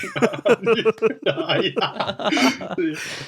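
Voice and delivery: big high burst of laughter. Real, not acted. Laughing at the shared confession.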